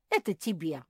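loud, emphatic pleading; impassioned loud plea; fervent apology. Acted. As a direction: beg forgiveness in a rush of shame. Hedgehog begs forgiveness for accusing him.

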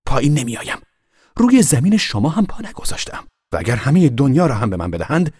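high, loud defiant reply; sharp retort. A narrator's reading. Boy refusing the girl's order, sharp. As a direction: snap right back at her. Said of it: "با همین تندی گفت".

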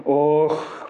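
usual speaking voice

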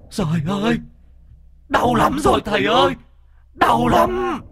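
piercing high wail of agony rising to a shriek; howling in pain. Acted. The tormented ghost howls to the master, high shrieking pain. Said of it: "nó rú lên".

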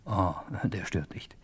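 confidential whisper; whispered aside. Acted. Narrator confiding to listeners about his name, whispered. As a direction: let the audience in on a secret.

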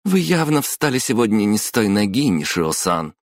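high, needling jab settling on the name; venomous retort. A narrator's reading. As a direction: needle the boss spitefully. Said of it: "ядовито ответила Майоко".

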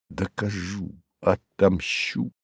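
hushed, slow inward vow; softly, as a thought kept to yourself; secret vow. Acted. Young man brooding alone on revenge, hushed vow.

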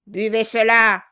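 loud, curt command; barked order. A narrator's reading. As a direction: order him to drink. Dwarf orders Brass to drink.

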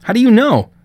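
loud, high and alarmed. Acted. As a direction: demand it in a panic. Son alarmed, arguing with father.

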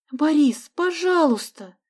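put-on girl begging Boris; pleading. A narrator's reading. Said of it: "умоляюще воскликнула Соня". (умоляюще, высоко и медленно)

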